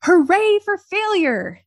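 high, drawn-out mock cheer; celebratory yell. Suggesting listeners cheer each failure, celebratory yell. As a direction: yell it like a playful victory cry. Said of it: "you could yell".

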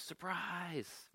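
high-pitched, soft imitation of a crowd yelling; mock surprise yell. Party guests greeting Agnes as she walks in, mock surprise yell. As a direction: hold the volume back on a high, pretend shout. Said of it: "everybody yells".

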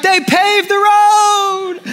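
high, slow, drawn-out cry of joy; excited kid shout. Retold or imitated. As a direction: announce it like the best news ever. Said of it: "and I was like".

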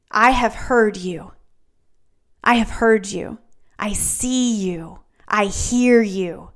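slow, measured, with long pauses; God gently answering a prayer; solemn reassurance. Acted. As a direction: let each short phrase land tenderly. Voices God answering Hezekiah's prayer.

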